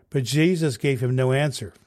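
ordinary delivery, nothing marked

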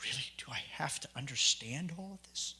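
whispered, reluctant aside; grumbling under breath. Retold or imitated. New convert grumbling to himself over the literature, hushed. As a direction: mutter it to yourself in disbelief. Retold private grumble while reading.

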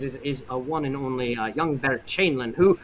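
normal talking voice

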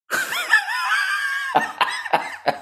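a high, shrieking fit of laughter. Real, not acted. Guest laughs at her own cruel joke, high shrieking laugh.

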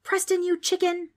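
put-on high-pitched accusing girl; indignant yell. A narrator's reading. Trix calls out Preston's cowardice, yelling. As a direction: yell the insult at a friend. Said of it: "Trix yelled at him".

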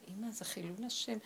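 soft, mortified aside imitating the son; hushed retold reproach; embarrassed son. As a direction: quietly plead as an ashamed son. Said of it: "כולו התבייש".

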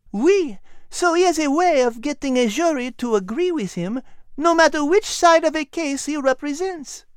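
high, small dog voice with a French touch; a tiny French dog explaining; high chatty dog voice; little French dog. A narrator's reading. Liz explains.

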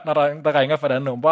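ordinary talk, nothing marked

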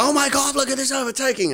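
very high, gushing excitement; excited fan. Retold or imitated. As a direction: squeal like a thrilled race fan. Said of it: "The comments".

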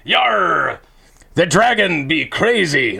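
put-on light pirate accent, yar; hammed-up pirate accent. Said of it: "A pirate's talking".

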